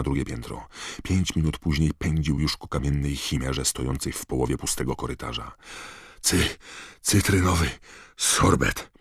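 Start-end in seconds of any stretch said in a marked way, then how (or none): 6.2-8.9 gasping the words out in broken pieces; out of breath, louder, halting between words; zasapany Harry. A narrator's reading. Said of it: "wysapał". (wysapane, bez tchu)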